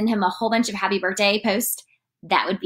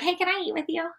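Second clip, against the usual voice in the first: small, high, soft voice; light imitation of a shy, friendly ask; casual, sweet request; 8 semitones higher, 6 dB quieter, faster than usual. Jesus to freaked-out disciples, casually asking to join them.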